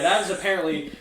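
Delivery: ordinary talk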